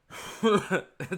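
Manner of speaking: loud, high burst of laughing; real incredulous laugh; burst of laughter. Real, not acted. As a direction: crack up at the very idea. Scoffs at a lopsided matchup.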